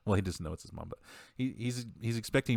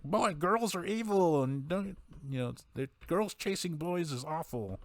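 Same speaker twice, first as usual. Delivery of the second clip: high, drawn-out prudish mom voice; mock a disapproving mother, pitched way up and slow; hammed-up prude scolding in a high voice; 9 semitones higher than usual. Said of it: "is very much a prude".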